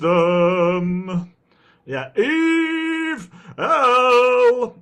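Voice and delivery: drawn-out name call, pitched high; fighting game announcer. Pretend. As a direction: stretch the name like a ring announcer. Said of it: "announcer voice".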